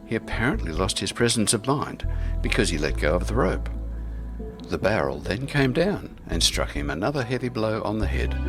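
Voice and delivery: usual speaking voice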